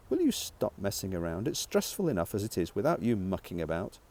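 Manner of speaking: ordinary delivery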